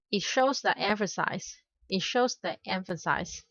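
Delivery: ordinary talk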